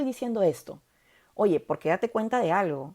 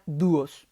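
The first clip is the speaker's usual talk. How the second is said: dúos with the ú stronger than the o; stress on the U; 3 semitones lower than usual. Shown as a demonstration. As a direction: draw out dúos, leaning on dú. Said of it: "si la U suena más que la O".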